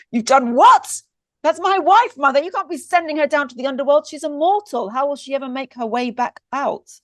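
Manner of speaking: high, loud, fast panicked protest; frantic outrage. Acted. Eros protests to his mother about his wife, high and frantic. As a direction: scold your mother in alarm.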